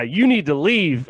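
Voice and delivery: retold heckler's yell; imitated yell. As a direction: call it out firmly, drawn out. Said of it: "some lady yelled at that guy".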